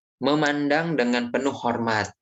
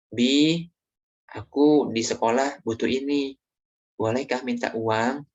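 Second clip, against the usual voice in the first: gentle, polite request; 3 semitones higher than usual. Shown as a demonstration. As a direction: ask kindly, gently rising at the end. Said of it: "disampaikan dengan lemah lembut".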